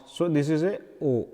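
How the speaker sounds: ordinary delivery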